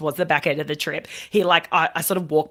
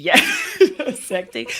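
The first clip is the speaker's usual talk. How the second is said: laughing; 8 semitones higher than usual. Real, not acted. Laughing recollection.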